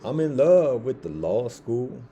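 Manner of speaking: loud and slow, leaning on every word; stretched-out heartfelt exclamation; emphatic declaration. Real, not acted. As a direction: declare it to the whole room. Emphatic declaration of loving school.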